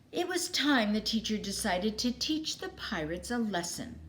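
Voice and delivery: usual speaking voice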